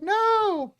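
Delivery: high, loud cry of protest; mock alarm. Acts out others' alarmed refusal.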